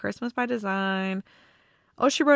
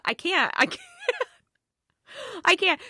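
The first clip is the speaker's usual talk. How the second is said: overwhelmed; 10 semitones higher than usual. Real, not acted. Remembering the mother scene, overwhelmed and laughing it off. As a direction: fight back tears with a laugh, going higher each time. Overcome recalling the emotional scene.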